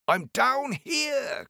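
very high, slow call from below; crying out. Acted. Tiny tailor crying up to the giant, very high. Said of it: "cried the tailor".

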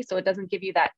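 normal talking voice

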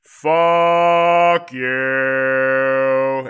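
very loud, long-held retort; huge, slow, mock-defiant yell; drawn-out shout. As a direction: bellow it, stretching both words right out. Retold hang-up on a telemarketer.

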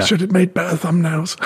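lightly voiced regretful creator; mock regret. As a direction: play the wistful failed YouTuber. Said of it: "You know".

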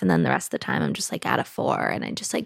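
drops to a creaky mumble; vocal fry, barely any energy; low-energy vocal fry. Shown as a demonstration. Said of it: "hover at a six".

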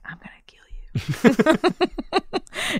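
slow, high whispered threat; mock murderous whisper. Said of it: "And I was like".

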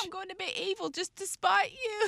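put-on high-pitched bratty kid; acted spiteful child. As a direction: threaten a parent like a petulant child. Voices a spiteful child to parent.

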